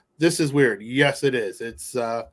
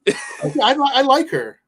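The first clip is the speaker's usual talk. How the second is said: excited; 11 semitones higher, 5 dB louder than usual. Real, not acted. Defends the character Beth.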